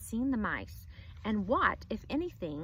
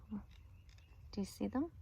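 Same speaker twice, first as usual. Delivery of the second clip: whisper; 11 dB quieter, slower than usual. Real, not acted. Teacher hushes class, points at picture.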